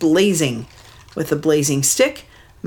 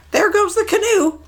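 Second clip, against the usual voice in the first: much higher little bird voice; mud hen. Acted. Mud hen spotting the canoe, high bird voice. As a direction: chirp it out like a small bird. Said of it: "he heard a mud hen say".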